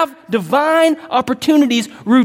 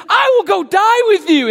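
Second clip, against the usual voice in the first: much higher, louder eager vow; eager Peter; 10 semitones higher, 4 dB louder than usual. Retold or imitated. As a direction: blurt it out like a rash disciple. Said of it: "he's like".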